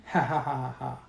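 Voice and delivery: acted taunting chuckle; mock villain laugh. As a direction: laugh tauntingly without raising the voice. Villain taunting sheriff on phone.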